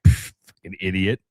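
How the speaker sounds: low, contemptuous scoff at a stranger; mock sneer at the guy in shorts; dismissive scoff. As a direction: brush him off with a sneer. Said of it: "It'd be so easy to be like".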